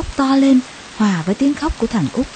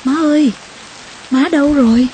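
put-on a child's anxious cry for mother; calling out for mother; 6 semitones higher than usual. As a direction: shout for mom from the bed, scared. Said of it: "gọi giật".

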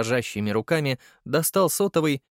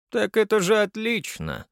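high smug chuckle in the voice; smirking; 10 semitones higher than usual. Acted. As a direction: grin through the words. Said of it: "усмехнулся Черчесов". (с усмешкой)

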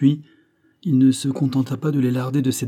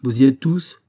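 raised-voice question, louder; calling out; 3 semitones higher, 5 dB louder than usual. A narrator's reading. Banks checking his companions are aboard, calling out. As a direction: call out to check on everyone. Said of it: "cria Banks".

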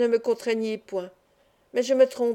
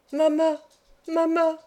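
put-on mild, higher-pitched child's cry for mother; 6 semitones higher than usual. A narrator's reading. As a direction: call out for mama in pain, without full volume. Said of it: "cria celui-ci".

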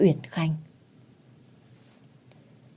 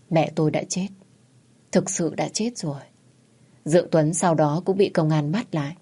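ordinary delivery, nothing marked

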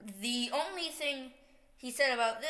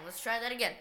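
normal talking voice

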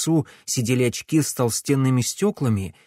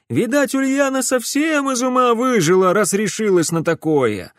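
townsman voice; 9 semitones higher, 4 dB louder than usual. A narrator's reading. Townsfolk chatter about Ulyana.

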